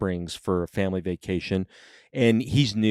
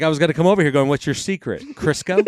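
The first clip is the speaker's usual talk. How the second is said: higher, louder teasing question, rising on Crisco; mock curious; 5 semitones higher, 4 dB louder, faster than usual. Teasing his new brother-in-law about his tan, mock curious. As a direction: needle him with a cheeky question.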